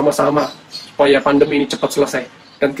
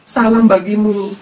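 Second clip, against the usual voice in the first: higher, slowly drawn-out greeting; greeting figure; 5 semitones higher, much slower than usual. Acted. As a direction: stretch the greeting out, pitched up. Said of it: "Tiba-tiba ngomong gini".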